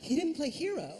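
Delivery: loud disbelieving whine; outraged disbelief. Retold or imitated. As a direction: protest like a wronged fan. Outraged at missing song.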